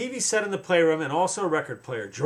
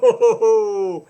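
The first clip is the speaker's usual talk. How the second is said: long, loud, higher-pitched whoa; joyride whoa; 4 semitones higher, 7 dB louder than usual. Pretend. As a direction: whoop it out as you hurtle downhill. George hurtling down the ramp.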